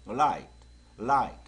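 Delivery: Light in one short, loud, light syllable; tongue tip up for a light L in Light. Shown as a demonstration. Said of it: "가볍죠?".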